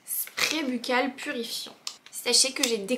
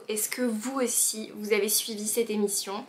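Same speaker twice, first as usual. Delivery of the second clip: normal talking voice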